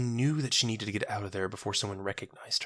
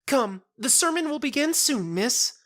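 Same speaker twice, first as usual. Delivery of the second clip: far higher and louder, bright and eager; childlike cheer; 6 dB louder than usual. Acted. Marifold cheerfully agreeing to Jenna's friend, childlike delight.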